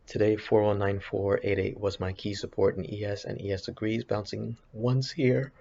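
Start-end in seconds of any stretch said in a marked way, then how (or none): 4.0-5.5 yawning mid-word, drawn out. Real, not acted. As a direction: talk through a yawn. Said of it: "Why do I always yawn".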